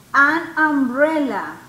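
"An umbrella" slow and loud, weight on the "um"; emphasized vowel onset. Shown as a demonstration. Teacher has students listen for the sound, loud slow "An umbrella". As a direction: hit the opening vowel in "An umbrella" slowly. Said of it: "¿Escuchan el sonido?".

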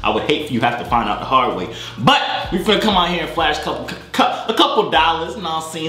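normal talking voice

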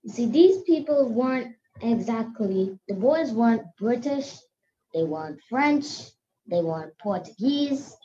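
ordinary talk, nothing marked

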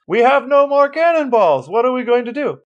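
high, loud put-on sailor voice; sheepish sailor. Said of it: "comes up sheepishly from underneath and says".

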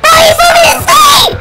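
high, loud laughing shout; real panicked laughing scream. Real, not acted. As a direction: yell while cracking up. Character falling asleep, panic.

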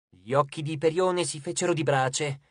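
ordinary delivery, nothing marked

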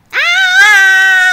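loud high yelp; sudden scream. Real, not acted. Kid screaming as he falls in the game. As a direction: scream out in shock.

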